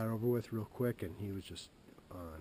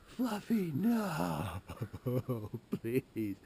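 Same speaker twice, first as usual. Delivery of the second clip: high pleading cry for the cat; high, loud mock-sobbing plea; childlike wail; 5 semitones higher, 6 dB louder, much slower than usual. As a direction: wail like a heartbroken kid.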